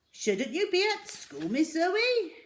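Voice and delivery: high gentle shopkeeper query; Raj asking. A narrator's reading. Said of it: "Raj asked".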